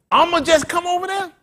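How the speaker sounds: high, loud incredulous repeat; mocking echo. Preacher mocking the flock's careless plans with a loud echo. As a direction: throw the careless words back at them.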